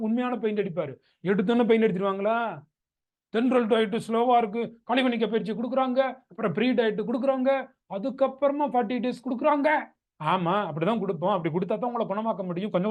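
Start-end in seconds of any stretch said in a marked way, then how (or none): 3.3-9.7 fast, higher mock grumble; patient's rapid list of gripes, mimicked; imitating complaining patient. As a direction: rattle off the complaints, pitched up. Mimics patient grumbling about slow diet.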